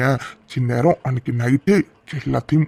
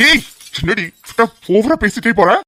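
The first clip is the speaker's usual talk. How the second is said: angry retort; 7 semitones higher than usual. Acted. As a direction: put her in her place. He snaps back at her.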